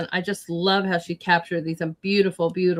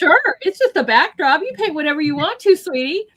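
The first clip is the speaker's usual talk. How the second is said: high, breezy, indulgent reply, louder; 10 semitones higher, 6 dB louder than usual. Retold or imitated. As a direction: wave it off cheerfully. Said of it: "I'm like".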